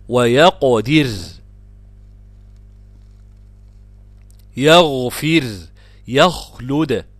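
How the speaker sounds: loud, deliberate recitation of ويقدر, يغفر, يخلد; word-by-word model recitation of ويقدر onward; tajweed demonstration. Shown as a demonstration. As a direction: hold the heavy غ in يغفر, bounce the ق in ويقدر. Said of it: "الساكن بعد الفتح".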